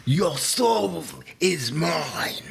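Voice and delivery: slow, eerie whisper; villain whisper. Retold or imitated. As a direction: hiss it like a soul-stealing villain. Mortal Kombat villain quote.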